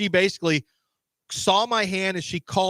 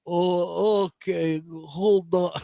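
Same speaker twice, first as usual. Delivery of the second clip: halting mock-Russian English; bad Russian accent; much slower than usual. Caught bluffer stalling the visitor, bad Russian accent. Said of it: "I'm doing a bad Russian accent".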